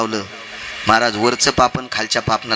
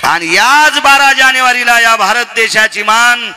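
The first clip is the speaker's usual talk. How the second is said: slow, raised, ringing proclamation; rousing preaching oratory; 6 semitones higher, slower than usual. Real, not acted. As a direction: build in pitch and force, stretching each phrase. Preacher's oratory on a great saint. (कीर्तनातील जोशपूर्ण, ताणलेली घोषणा)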